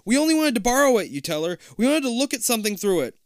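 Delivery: fast, high, loud plea; panicked pleading. Acted. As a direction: blurt it out in panic. Frightened kid pleading.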